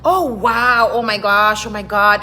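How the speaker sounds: mock awe. Locals raving about a newcomer's English, loud mock awe. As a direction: imitate people making a big deal. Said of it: "it's like a big deal".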